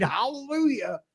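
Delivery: hallelujah. Real, not acted. Celebrating the nighttime parade.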